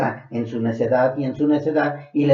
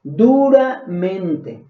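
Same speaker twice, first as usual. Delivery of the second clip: very slow "Duramente", every syllable stretched and falling; a weighty, drawn-out "Duramente"; slow emphasis; much slower than usual. Shown as a demonstration. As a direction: draw out "Duramente" to drive it home. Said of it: "No dice durante".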